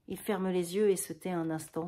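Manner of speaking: usual speaking voice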